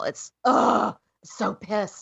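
mock growl of frustration; demonstrated pissed-off venting; demonstrated physical anger. Shown as a demonstration. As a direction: act out anger felt in the body. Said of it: "Expressing your anger is".

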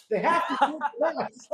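high-pitched, laughing exclamation; excited laughter. Real, not acted. Laughing at his own excited story.

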